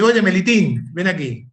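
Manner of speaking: voz del padre más aguda y fuerte; llama al hijo como un padre cariñoso; padre llamando al hijo. Acted. (padre llamando a su hijo, más agudo y fuerte)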